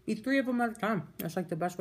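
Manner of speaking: eating while speaking; talking while chewing. Real, not acted. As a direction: mouth partly full of fries. Seen 1: Puts fries in mouth at 3.5-4.2, then talks while chewing.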